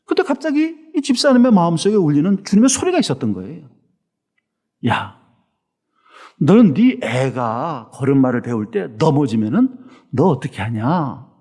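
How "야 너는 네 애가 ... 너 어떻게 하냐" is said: lower, slow and gentle fatherly voice; gentle voice of the Lord. Retold or imitated. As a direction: speak as a kind Lord asking a question. Lord's voice in the story.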